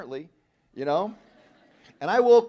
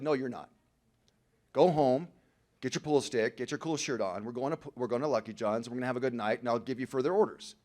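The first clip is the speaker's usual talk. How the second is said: a lower, softer, coaxing voice of the addiction; voice of alcoholism; 5 semitones lower, 4 dB quieter than usual. Acted. Alcoholism personified giving him orders, low and coaxing. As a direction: lure him back out like a sly tempter. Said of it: "Alcoholism just showed back up and said".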